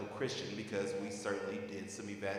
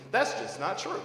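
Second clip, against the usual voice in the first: much higher, louder mock voice of a science denier; mocking dismissive people; 11 semitones higher, 10 dB louder than usual. Panelist mocking those who reject science, high mock scoff. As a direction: scoff it like a know-nothing brushing off experts.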